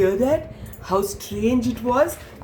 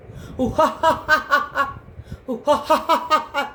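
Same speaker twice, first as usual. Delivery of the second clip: strange kookaburra laugh; 6 semitones higher than usual. A narrator's reading. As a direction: imitate a kookaburra's rattling "ha-ha-ha" call. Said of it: "strangest sound".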